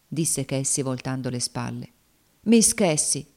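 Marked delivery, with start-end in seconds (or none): 2.4-3.2 raised, louder call of entreaty; imploring. A narrator's reading. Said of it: "implorò Tom gettandosi innanzi a lei".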